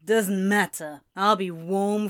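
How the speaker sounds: a hint of gloomy drunken fatalism; sulky drunk demon. Drunk demon sulking to the angel about his fate. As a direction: shrug it off morosely.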